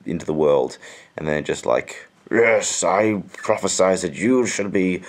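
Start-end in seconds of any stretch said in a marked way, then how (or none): 2.3-5.0 loud, slow, solemn prophet voice. Retold or imitated. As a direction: boom out a prophecy. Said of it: "prophet babies with epic beards".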